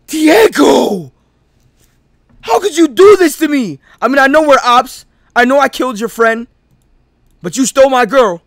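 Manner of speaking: high, loud wounded accusation; melodramatic hurt. Acted. Tyrell to Diego, hammed-up hurt shout. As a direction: cry out the betrayal.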